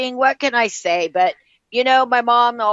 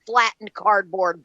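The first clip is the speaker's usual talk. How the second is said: usual speaking voice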